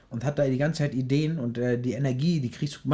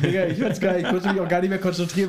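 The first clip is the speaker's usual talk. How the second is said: laughing; 5 semitones higher than usual. Real, not acted. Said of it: "weil ich die ganze Zeit lachen musste".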